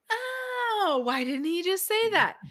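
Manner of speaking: high, bright-eyed aha exclamation; exasperated aha; sudden realization. Retold or imitated. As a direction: voice leaps up as it finally clicks. Finally understands second book.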